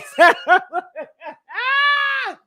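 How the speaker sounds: high, long wailing laugh; loud laugh. Real, not acted. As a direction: burst out in a long high cackle. Laughs at own joke.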